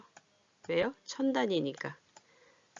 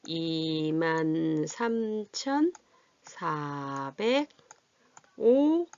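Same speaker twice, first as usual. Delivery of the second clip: very slow, syllables held long; drawn out while writing; much slower than usual. Real, not acted. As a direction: pause after each place value as you write. Writing the number while saying it.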